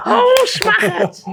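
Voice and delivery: squeaky high cry, stretched out slow; squealed. Real, not acted. Reacting to being called fat, in a slow high squeal. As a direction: squeal it in shock.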